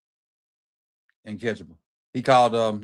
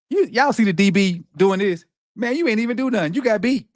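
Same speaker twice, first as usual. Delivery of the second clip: high, raised-voice taunt; indignant scolding; 7 semitones higher, 3 dB louder than usual. Real, not acted. Football streamer telling off a beaten defender, indignant scolding. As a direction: call out the defender, pitched up.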